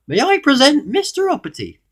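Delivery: very high, loud presentation; goblin announcing. A narrator's reading. Goblin presenting a guest to his king, high announcement. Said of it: "announced the goblin".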